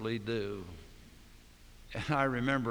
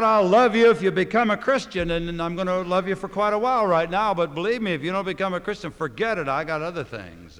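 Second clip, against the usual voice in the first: loud, higher, fast mock of a fickle promise; mock conditional lover; 7 semitones higher, 11 dB louder than usual. Preacher mocking conditional love to congregation, loud and scornful. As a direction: lampoon someone whose love comes with a deadline.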